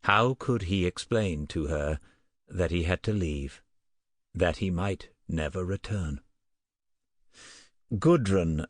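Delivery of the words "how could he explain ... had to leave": plain reading voice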